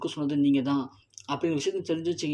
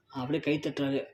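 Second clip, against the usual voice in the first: ordinary delivery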